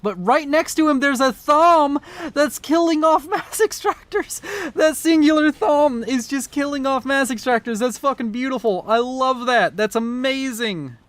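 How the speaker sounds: high-pitched, thrilled play-by-play; excited commentary. Real, not acted. Game caster marvelling at one Thom's kills, excited. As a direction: call it with mounting amazement, pitch way up.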